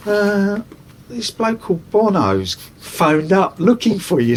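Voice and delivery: a hint of a friend's mother passing on a message; imitating a mum relaying a phone call; lightly voiced mother. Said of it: "friends' mothers would go".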